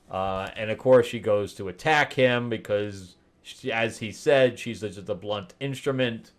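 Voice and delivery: normal talking voice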